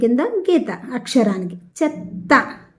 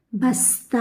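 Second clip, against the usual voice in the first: usual speaking voice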